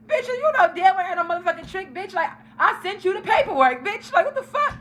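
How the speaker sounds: high, indignant rant; retold angry outburst. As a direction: go off on a friend, pitch climbing high. Retold angry call to friend.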